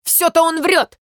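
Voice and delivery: loud, high protest; shouted accusation. Acted. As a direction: call him a liar at full voice. Ruff shouts accusation from water.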